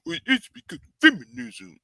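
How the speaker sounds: high-pitched mocking whine of an objector. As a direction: mimic a silly objector in a high voice. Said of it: "I know somebody going, wanna use this whole".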